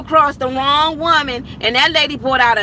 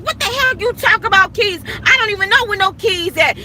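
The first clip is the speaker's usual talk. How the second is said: mock indignant retort; 6 semitones higher than usual. Annoyed retort about the keys.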